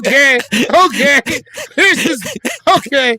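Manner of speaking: high, loud mock reactions in short bursts; put-on, over-excited reaction voice; full reaction guy. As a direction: blurt it like a hammed-up reaction-video guy. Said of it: "I'm going full reaction guy".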